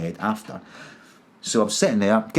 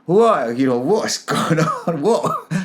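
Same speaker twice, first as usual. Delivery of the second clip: high, incredulous laugh-tinged what; retold giddy disbelief, pitched right up; bewildered and giggly, voice jumping high; half laughing, half crying; 8 semitones higher, 4 dB louder, slower than usual. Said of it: "but I'm kind of laughing".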